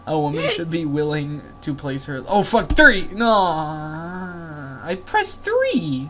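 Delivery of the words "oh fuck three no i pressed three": high, loud cry of dismay; frustrated outburst. Real, not acted. Player blurting out the button pressed mid-game, frustrated outburst. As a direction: protest loudly, drawing out the key word.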